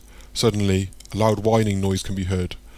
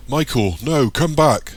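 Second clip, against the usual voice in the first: high, loud call after someone; shout; 6 semitones higher, 7 dB louder, slower than usual. A narrator's reading. Eric shouting after fleeing Michael, loud and high. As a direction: shout to stop him leaving. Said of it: "shouts Eric".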